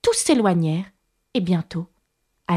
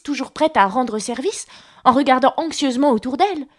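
usual speaking voice

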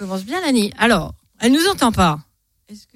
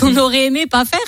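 laughing quote; 4 semitones higher, 6 dB louder than usual. Retold or imitated. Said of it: "t'as dit?".

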